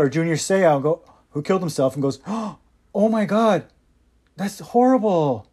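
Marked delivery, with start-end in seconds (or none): 2.2-5.5 slow, drawn-out mock horror; gasp, then fake dismay slowly; hammed-up slow gasp of horror; mock shock. Mock dismay after tragedy.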